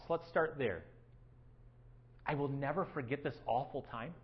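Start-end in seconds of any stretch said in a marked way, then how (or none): 2.2-4.1 softly repeated, low and hushed; quiet echo. Shown as a demonstration. Pastor to congregation, echoing the verse quietly. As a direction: let the words sink in quietly. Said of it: "Anybody feel like that?".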